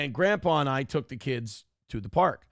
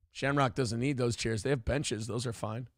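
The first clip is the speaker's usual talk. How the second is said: lower, softer, dismissive; dismissive kid; 4 semitones lower, 5 dB quieter than usual. Retold or imitated. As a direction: brush it off, unimpressed. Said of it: "purposely being not impressed".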